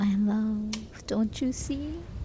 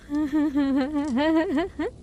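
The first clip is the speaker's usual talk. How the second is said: laughing; 7 semitones higher than usual. Acted. Witch laughs at frightened captive.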